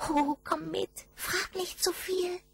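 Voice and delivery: very high, thin goblin voice calling out; high kobold voice. A narrator's reading. Goblin thanking the shepherd boy, very high voice. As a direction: squeak it like a tiny goblin. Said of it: "sagte der Kobold".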